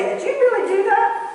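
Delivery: high-pitched disbelieving question; incredulous question. Acted. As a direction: ask in high, rising disbelief. Voices someone confronting her directly.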